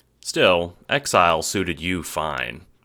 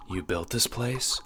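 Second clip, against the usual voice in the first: higher, softer doubting question; skeptical Prospidian; 6 semitones higher, 5 dB quieter than usual. A narrator's reading. Prospidian doubting the renegade's claim, skeptical. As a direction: question it quietly, in a raised pitch. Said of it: "quirking a brow".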